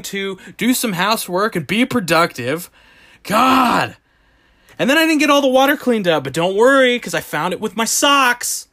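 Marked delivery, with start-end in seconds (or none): 3.2-4.0 higher, frustrated cry; exasperated outburst. Real, not acted. After the dishwasher floods, exasperated outburst. 4.8-8.7 high, rushed sarcastic gripe; exasperated sarcasm. Real, not acted. Griping about water found with his socks, exasperated sarcasm.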